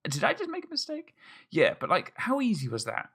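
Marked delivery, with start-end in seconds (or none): 0.0-1.1 self-doubting quote. Retold or imitated. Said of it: "you're like".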